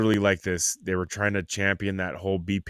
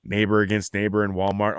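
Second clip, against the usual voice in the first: normal talking voice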